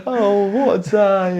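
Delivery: put-on high, slow squeaky baby voice; drawn-out squeak of a newborn; mock newborn voice. Repeats the Harvey voice.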